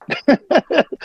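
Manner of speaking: high brief chuckle; short laugh. Real, not acted. Host chuckling at his shootout joke. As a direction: laugh at your own line. Amused at own retort.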